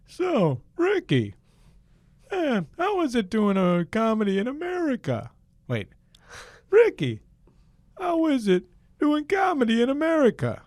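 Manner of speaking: very high, loud, bad impression; hammed-up squeal of a talk-show comedian; Jerry Seinfeld. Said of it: "my Jerry Seinfeld is not great".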